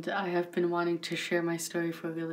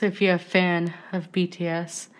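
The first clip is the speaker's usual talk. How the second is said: ordinary talk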